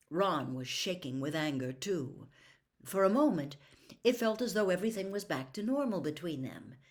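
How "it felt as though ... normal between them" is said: ordinary delivery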